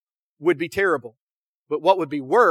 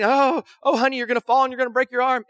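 higher, quick, fretful mother's fuss; freaking out mom; 5 semitones higher than usual. Retold or imitated. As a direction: panic a bit, like a mom watching her boy climb. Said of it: "and she's freaking".

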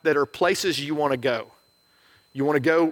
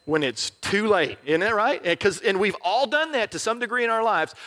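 ordinary delivery

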